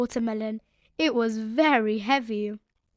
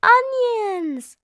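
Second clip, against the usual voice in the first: high loud cry; excited cry; 10 semitones higher, 6 dB louder than usual. A narrator's reading. Peppa remembering onions, loud. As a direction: shout out remembering. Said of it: "cried Peppa".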